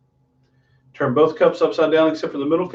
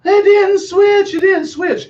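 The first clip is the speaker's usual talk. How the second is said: much higher, slow, whiny schoolkid voice; kids protesting; 5 dB louder, much slower than usual. Retold or imitated. Magician voicing schoolkids who insist the jars didn't switch, in a high whiny kid voice. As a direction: play a protesting little kid at an assembly. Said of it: "they're all".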